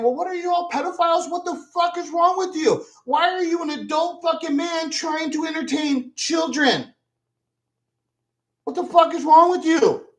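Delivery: mock outraged rant, pitched high; hammed-up furious tirade at grown men; retold angry yelling. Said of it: "that's when I start yelling".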